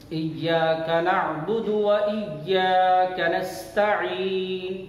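slow, melodic tajweed chant; measured, sustained recitation on a steady note; Quran recitation. Shown as a demonstration. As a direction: chant it with long, drawn-out vowels. Quran recitation for pupils to follow.

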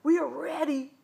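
put-on high, loud kids' reply; excited cry. A narrator's reading. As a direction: shout back eagerly, ready for launch. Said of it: "cried the Cut-Ups".

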